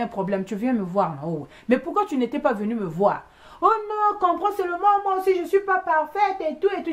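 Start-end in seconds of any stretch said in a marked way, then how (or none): 3.6-6.9 pleading excuse. Retold or imitated. Acts the other woman excusing herself.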